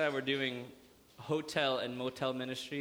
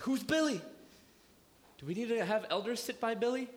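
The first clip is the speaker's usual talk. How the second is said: alarmed churchgoers; 8 semitones higher than usual. Retold or imitated. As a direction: play nervous church members. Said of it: "Everyone's like".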